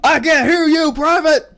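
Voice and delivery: high, loud barking drill sergeant; drill sergeant shout. Acted. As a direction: bark orders at the troops. Skit sergeant demands louder reply.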